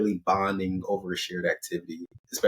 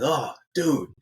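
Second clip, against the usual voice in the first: acted, higher-pitched stoked buddy; mildly hyped, pitched up; overwhelmed excitement; 4 semitones higher than usual. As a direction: gush lightly, as if a bit overwhelmed. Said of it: "he's, like, overwhelmed".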